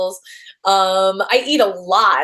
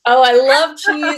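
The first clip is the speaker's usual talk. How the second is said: higher and louder, gushing over cheese; enthusiastic; 5 semitones higher, 5 dB louder than usual. Real, not acted. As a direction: declare your love of cheese with big enthusiasm. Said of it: "I love how enthusiastic you are about cheese".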